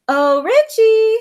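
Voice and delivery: high, slow sweet coo. Acted. Sister sweetly cooing to lure her brother, high slow coo. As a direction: sweet-talk him to set the trap. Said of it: "I cooed".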